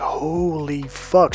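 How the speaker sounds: very slow, drawn-out mock awe; acted-out dumbfounded amazement; imitated stunned realization. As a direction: stretch each word in put-on astonishment. Retold girlfriend's sudden realization.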